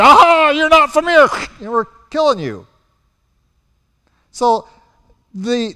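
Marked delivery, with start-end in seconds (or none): 0.0-2.7 loud, high mock menace; guard bellowing at a stranger, loud and high; mock threat. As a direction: bark it like a border guard catching an outsider. Guards catching outsider.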